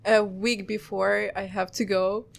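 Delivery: normal talking voice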